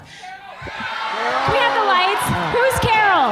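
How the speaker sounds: host rallying the live crowd; calling out. Real, not acted. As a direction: shout it out to the audience.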